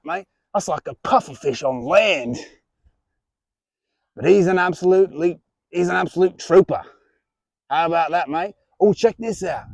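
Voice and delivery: broad put-on Australian accent, hearty host; jolly Australian reptile presenter; Australian wildlife host. Plays a crocodile-hunter style host.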